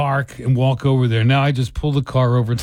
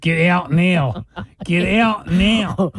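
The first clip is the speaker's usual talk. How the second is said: higher, drawn-out mock Philly; mock Philly accent; 5 semitones higher, much slower than usual. Joking about kicking the girl out of the car, mock Philly accent. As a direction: stretch the vowels in a hammed-up Philly accent. Mimicking the accent just discussed.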